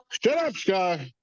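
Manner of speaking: high, drawn-out annoyed command; scolding the dog. Real, not acted. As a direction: call out slowly to hush a barking dog. Scolding own barking dog.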